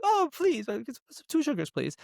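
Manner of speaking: hammed-up British accent asking for sugar; high mock British accent; posh British reply. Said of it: "so they're all British".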